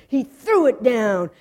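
ordinary delivery

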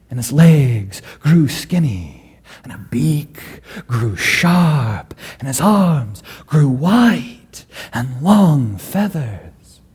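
usual speaking voice

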